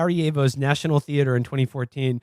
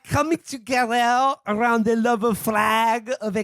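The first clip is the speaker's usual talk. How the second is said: put-on high, slow French accent; mock French accent, drawn out; 5 semitones higher, slower than usual. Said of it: "I'm not gonna do the French accent anymore".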